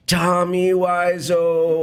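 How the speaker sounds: slow, high, drawn-out dying words; dramatic death scene. Pretend. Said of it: "I'll show you my death scene".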